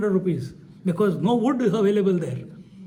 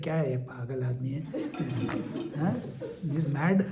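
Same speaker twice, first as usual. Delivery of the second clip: muttering under his breath; 5 semitones lower, 6 dB quieter, much faster than usual. Retold or imitated. Muttering inner thought, laughing.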